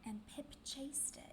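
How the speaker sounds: breathy storybook whisper. A narrator's reading. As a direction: hush it, like a secret chase. Repeated chase, first take whispered.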